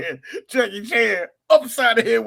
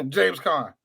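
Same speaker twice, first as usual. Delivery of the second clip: ordinary delivery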